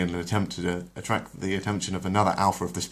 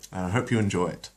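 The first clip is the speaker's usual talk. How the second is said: plain reading voice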